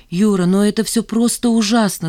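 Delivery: plain reading voice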